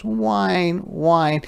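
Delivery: high, distressed cub whine; high-pitched little whines; whining wolf cub. Acted. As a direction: whimper high like a stuck puppy. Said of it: "sinks in the deep snow and goes".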